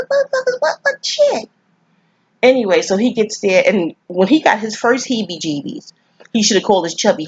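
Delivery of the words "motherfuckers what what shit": very high, squeaky incredulous reaction; retold disbelief. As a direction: squeal it in mock outrage. Acts out disbelief at thrown deer.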